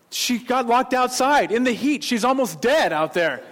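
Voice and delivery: high, loud alarmed cry; panicked. Retold or imitated. Panic over locked-out daughter.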